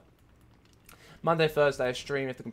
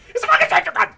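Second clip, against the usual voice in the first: very high, very loud screech; outraged scream; 18 dB louder, faster than usual. Real, not acted. Player killed by a sentry gun, outraged high scream. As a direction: scream it at the top of your range.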